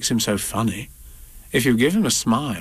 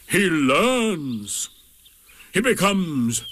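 slow, higher-pitched donkey lecture; ponderous, self-important donkey; 6 semitones higher, slower than usual. A narrator's reading. Eeyore explaining learning to Rabbit, self-important and solemn. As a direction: draw out each grand word with long pauses.

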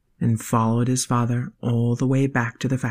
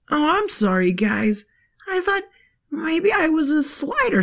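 put-on kid voice; 13 semitones higher than usual. Q-Rex apologizes.

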